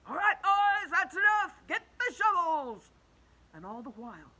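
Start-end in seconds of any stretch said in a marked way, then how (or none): 0.1-2.9 high, loud boss calling orders; cloudman shouting. Acted. Cloudman ordering the others to shovel, loud high shout. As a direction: bellow across the cloud to the crew. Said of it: "they heard him shouting".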